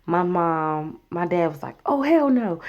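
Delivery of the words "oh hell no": high-pitched mock outrage; going off. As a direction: imitate dad's indignant outburst. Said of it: "He just going all the way off".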